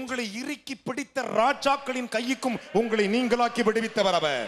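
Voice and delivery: rousing, high-pitched sermon delivery; full-force preaching. Real, not acted. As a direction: preach it out to the crowd. Preacher proclaims God's deliverance.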